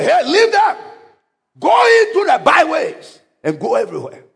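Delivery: high, forceful command; master's command. Acted. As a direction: send the servant out sharply. Angry master sends servant out.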